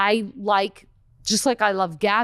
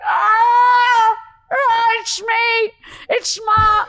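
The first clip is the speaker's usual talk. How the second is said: high, loud wailing mother; crying mother shout; 14 semitones higher, 6 dB louder, much slower than usual. Retold or imitated. Mother caught as the tooth fairy, crying out loud and high. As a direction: howl it from the floor. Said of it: "she's like".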